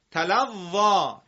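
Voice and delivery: تلوا read slowly, ending in a long a sound; drawn-out demo; slow model reading. Shown as a demonstration. Teacher slowly models the word for the class. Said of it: "تلوا خوانده میشه".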